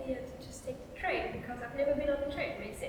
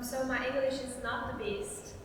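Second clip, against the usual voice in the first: ordinary delivery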